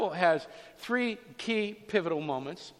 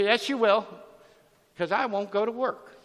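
loud, higher, insistent retort; retold defiant comeback; 5 semitones higher, 6 dB louder than usual. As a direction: imitate her talking back to the judge. Altercation with the judge.

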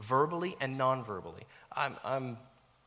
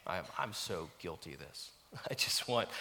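ordinary talk

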